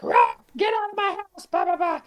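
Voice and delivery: hammed-up furious father; angry dad. The dad throwing Don Giovanni out, mock roar.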